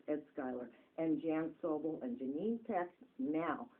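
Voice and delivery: normal talking voice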